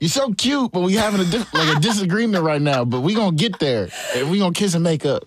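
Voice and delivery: high, sweet pleading lover voice; imitating himself sweet-talking his girlfriend mid-argument. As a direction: coax her gently out of an argument. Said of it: "I'm like".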